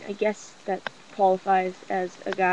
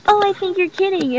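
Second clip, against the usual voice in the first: higher, louder put-on girl's reply; mock oblivious cousin; 8 semitones higher, 7 dB louder than usual. Imitating cousin brushing off the guy's rejection, mock oblivious. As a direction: imitate her brushing it off, loud and high.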